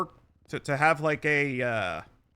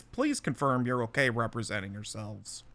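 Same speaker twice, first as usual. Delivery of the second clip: ordinary talk, nothing marked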